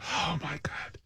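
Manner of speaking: hushed, barely voiced disbelief; stunned whisper. Real, not acted. Stunned at talking duck clip.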